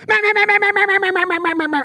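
very high, rushed run of meh sounds; humanistic duck call. Shown as a demonstration. Mocking how human callers rush duck calls, high quacks. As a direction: rattle off quacks too fast and even. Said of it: "a human will get in there and go".

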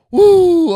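loud, high whoop; long whoop of guilty amusement. Real, not acted. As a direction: let out a big high "woo". Whoops after laughing at himself.

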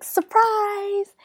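high, drawn-out surprise reveal; excited surprise. Retold or imitated. Wife springing anniversary outing on husband, excited surprise. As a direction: imitate a wife springing a treat.

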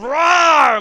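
roaring lion impression. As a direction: let out a full lion's roar. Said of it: "he jumps up and goes".

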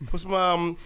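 usual speaking voice

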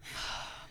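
soft, fed-up exhale; exasperated sigh. Retold or imitated. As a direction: let out a weary sigh before answering. Fed up before retort.

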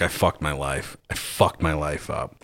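retold self-pity, low and soft; quiet despair. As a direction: mutter it like he has given up.

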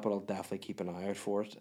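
ordinary delivery

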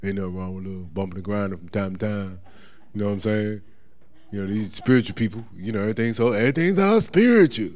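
low laid-back mocking voice; lazy brushing-off voice. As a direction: shrug it all off like a backslider. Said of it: "you be like".

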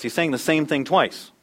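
ordinary talk, nothing marked

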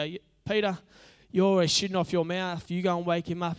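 playfully acted squabbling; bickering disciple. Disciples bickering, one orders Peter.

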